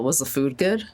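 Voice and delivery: ordinary talk, nothing marked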